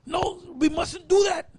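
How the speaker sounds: high, soft, pleading protest; acted fearful reluctance. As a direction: play the witch holding back in fear. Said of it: "she probably held back".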